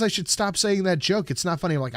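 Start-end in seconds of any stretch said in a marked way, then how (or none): none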